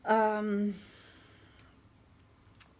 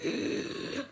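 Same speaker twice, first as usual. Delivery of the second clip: breathless unvoiced gasp; just air, no voice, gasping; airy gasp copied from memory; imitated gasp; 6 semitones higher than usual. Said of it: "he's like".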